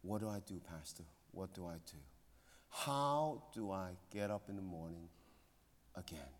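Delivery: soft mock-tearful whisper of a mourning mother; retold crying plea. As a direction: quietly echo her broken question. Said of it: "for fifteen minutes crying".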